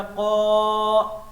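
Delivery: قا held very long and loud; stretched qaa. Shown as a demonstration. Teacher showing the child how to stretch قا, drawn out. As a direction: pull the قا out long. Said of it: "بیٹا اس کو کھینچو".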